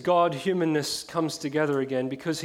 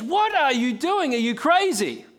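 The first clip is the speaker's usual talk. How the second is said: indignant bellow; 8 semitones higher, 4 dB louder than usual. Retold or imitated. Crowd bellowing at the woman with the perfume, high and loud. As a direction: berate her as if she's lost her mind. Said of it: "all the people around the woman were bellowing at her".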